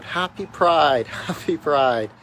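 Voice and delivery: cheery shout from a float; yelling out cheers. Retold or imitated. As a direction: call it out to a parade crowd. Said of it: "yelling out".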